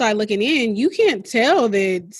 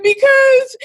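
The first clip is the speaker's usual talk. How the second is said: tearful because; 7 dB louder than usual. Retold or imitated. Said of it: "I said".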